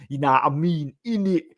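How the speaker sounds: lightly sneering London gangster put-on; fake London gangster accent. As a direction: take the piss out of a wannabe-hard London gangster accent. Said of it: "doing his fake gangster accent".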